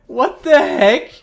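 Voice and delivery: loud, slow, high cry of disbelief; amazed shout. Real, not acted. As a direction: drag it out, stunned. Reacting to a great play.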